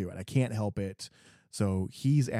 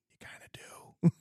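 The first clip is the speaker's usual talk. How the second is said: 1 teasing whisper; 12 semitones higher than usual. Real, not acted. Teasing aside to rest of US.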